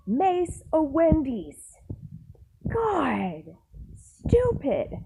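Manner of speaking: slow, then a high, disgusted snap on stupid; exasperated. Retold or imitated. Worker fuming at the coworker who maced the store. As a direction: roll your eyes at the idiot.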